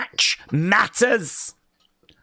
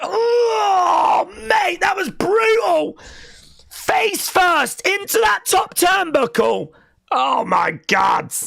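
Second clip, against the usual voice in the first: shocked excited shout; 13 semitones higher, slower than usual. Real, not acted. Streamer screams at brutal spot in shocked excitement. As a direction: react like the hit hurt you.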